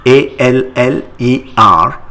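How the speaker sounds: usual speaking voice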